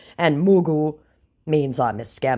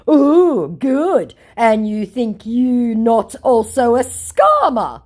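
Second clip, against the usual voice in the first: high, loud, slow scammer; mock scammer voice; 10 semitones higher, 7 dB louder, much slower than usual. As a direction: gush then accuse like the scammer. Voicing the scammer.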